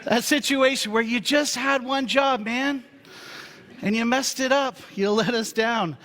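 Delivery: high, loud, hammed-up disappointment; mock scolding. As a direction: play it big like a let-down fan scolding. Mock scolding over a meme.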